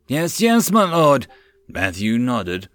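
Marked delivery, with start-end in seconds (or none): 0.1-1.3 servile reply. A narrator's reading. Ex-slave answering lord.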